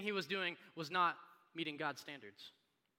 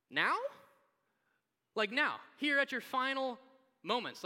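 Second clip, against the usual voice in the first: higher-pitched, emphatic disbelief; incredulous Jesus; 6 semitones higher, 3 dB louder than usual. Acted. Imagined Jesus to dying criminal, incredulous raised objection. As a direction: pile up the charges in raised disbelief. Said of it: "Like Jesus is like".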